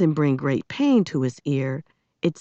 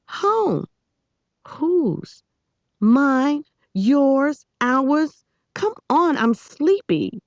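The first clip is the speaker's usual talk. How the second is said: put-on insistent girl; 9 semitones higher, much slower than usual. A narrator's reading. Amanda insisting brightly.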